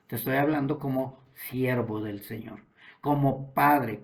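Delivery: ordinary talk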